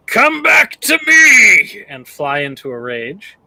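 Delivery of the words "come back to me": high, slow raging war cry; battle shout. Acted. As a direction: bellow at the fleeing beast. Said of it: "I'm going to shout at it".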